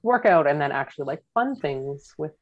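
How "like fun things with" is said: ordinary talk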